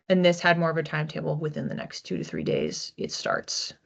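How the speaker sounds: ordinary talk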